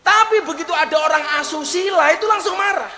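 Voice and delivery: high, loud sermon delivery; rousing pulpit preaching; fiery preaching. Acted. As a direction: preach it at full force to the crowd. Preaching at full force.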